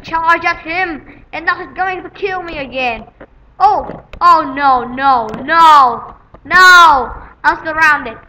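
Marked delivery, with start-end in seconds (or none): 3.6-8.2 rising panic. Real, not acted. Gamer cornered by enemies, panicked rising cry. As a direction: dread climbing to a cry. Getting surrounded in game.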